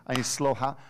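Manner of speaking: ordinary talk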